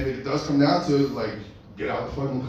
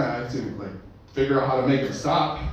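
ordinary talk

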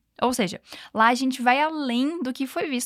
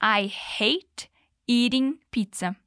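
slow example; slower than usual. Shown as a demonstration.

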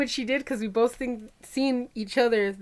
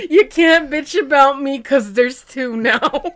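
loud, high, drawn-out gloating; mock defiant boast; 5 semitones higher, 11 dB louder, slower than usual. Girl boasting at classmates in retelling, mock defiant. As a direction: crow it slowly and loudly, amused.

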